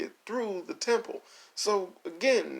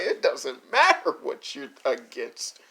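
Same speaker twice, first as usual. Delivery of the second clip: mock stern reply; 3 semitones lower, 5 dB louder, slower than usual. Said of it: "And it was like".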